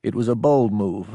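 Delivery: ordinary delivery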